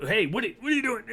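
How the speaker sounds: high, loud, flustered outburst; startled protest. Acted. Guard to player yanking the chain from under his foot, startled protest.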